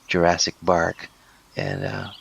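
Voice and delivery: normal talking voice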